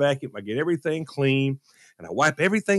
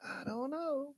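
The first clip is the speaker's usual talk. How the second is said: high, soft, slow mock wife; 15 dB quieter, slower than usual. Husband mimicking wife's doubtful praise, high and soft. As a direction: mimic her doubtful reply, much softer. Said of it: "They're like".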